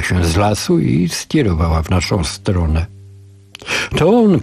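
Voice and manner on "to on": a sudden high cry of recognition. A narrator's reading. Family crying out as the stranger approaches, a high cry. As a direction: shout it out, pitched high. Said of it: "krzyknęli razem Zdenka".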